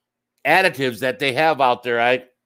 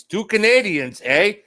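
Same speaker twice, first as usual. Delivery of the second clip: higher, hammed-up Canadian accent with a tagged-on eh; mock Canadian accent; 5 semitones higher than usual. Host teasing two Canadian guests, mock Canadian accent. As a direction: tease it like a joking Canadian.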